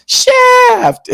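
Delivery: very high, loud sung yell. Retold or imitated. Belts out the Shaft theme.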